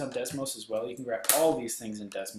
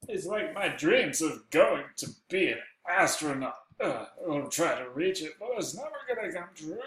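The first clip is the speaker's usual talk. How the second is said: mock wistful; 4 semitones higher, 4 dB louder than usual. That's not actually one of my dreams.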